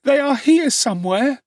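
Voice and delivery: put-on a frightened boy pleading with the officer. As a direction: beg desperately to be believed. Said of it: "clasping his hands passionately".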